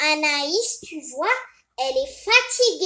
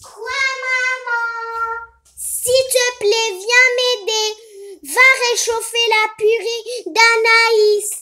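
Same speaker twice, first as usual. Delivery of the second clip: put-on lightly higher sing-song boy voice; son Niso doll voice; 5 semitones higher, 4 dB louder than usual. Son answering his mother's call, lightly higher sing-song. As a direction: play the helpful son, a bit higher.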